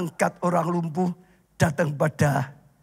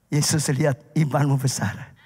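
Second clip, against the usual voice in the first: usual speaking voice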